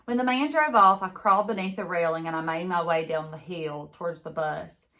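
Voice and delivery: ordinary delivery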